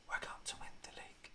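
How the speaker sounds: breathy secret whisper after the chant; hushed unvoiced whisper. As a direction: drop to a conspiratorial whisper.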